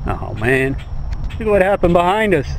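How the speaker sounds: normal talking voice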